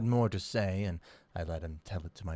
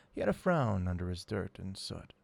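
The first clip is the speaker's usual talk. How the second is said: ordinary delivery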